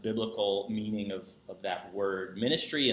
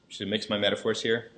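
usual speaking voice

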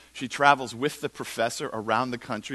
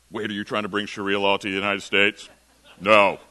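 loud, lower, indignant put-on voice; mock suspicious objector; 6 semitones lower, 6 dB louder than usual. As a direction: refuse loudly, mocking the suspicion. Mimics suspicious Christians refusing.

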